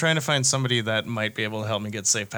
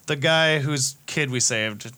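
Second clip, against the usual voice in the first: ordinary delivery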